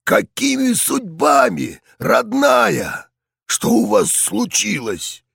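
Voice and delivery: put-on tender, raspy old man voice; a fond old general asking kindly. General greets Liza tenderly.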